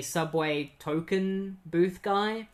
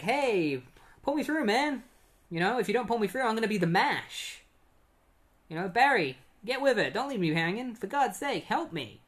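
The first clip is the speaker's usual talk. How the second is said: higher-pitched, mock-desperate plea; urgent pleading; 6 semitones higher than usual. Wally stuck in the force field begs Barry, pleading. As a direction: beg a friend to pull you through.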